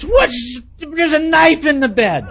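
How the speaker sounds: high, loud alarmed cry of surprise; shocked outburst at the knife; startled. Retold or imitated. Husband shocked to find a knife in the bed, loud alarm.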